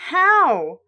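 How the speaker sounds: shocked. Acted. Dad shocked at explosion.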